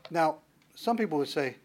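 ordinary delivery

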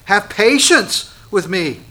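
slow, high, loud begging plea; pleading slave. Acted. A slave begging his lord for patience, slow loud plea. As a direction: beg for mercy on your knees.

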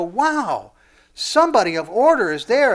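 loud, marvelling; awed realization. Acted. Onlookers seeing order in the cosmos, awed. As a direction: exclaim it in wonder.

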